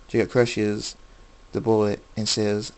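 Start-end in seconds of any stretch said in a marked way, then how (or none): none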